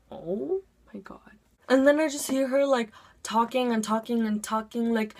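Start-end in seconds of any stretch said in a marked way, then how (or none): 0.1-1.4 slow, hushed disbelief; shocked whisper. Retold or imitated. Eavesdropping student reacting to teacher, stunned whisper. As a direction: whisper it, drawn out and aghast.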